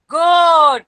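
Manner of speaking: "goat" loud and stretched; word demonstration. Shown as a demonstration. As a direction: call out "goat" with a long vowel for listening children. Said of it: "whether you can hear the G sound".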